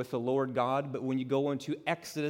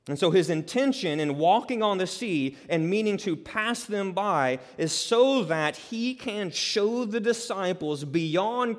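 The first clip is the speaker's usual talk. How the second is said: preaching at full force; 4 semitones higher, 5 dB louder than usual. Acted. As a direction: drive the point home to the congregation.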